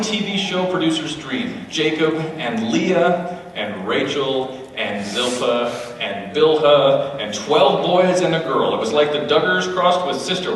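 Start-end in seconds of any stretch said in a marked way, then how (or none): 1.7-8.7 slow list. Acted.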